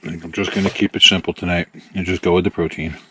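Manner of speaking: talking while chewing; mouth full. Real, not acted. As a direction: speak through a mouthful of chicken. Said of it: "talking with his mouth full".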